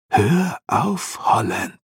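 drawn-out demon warning, starting high; high first words, falling onto the name. A narrator's reading. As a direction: warn him off as a demon in his head. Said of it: "warnte ihn der Dämon".

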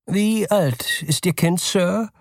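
polite clerk. Acted. Store clerk asks the nervous father, polite and high.

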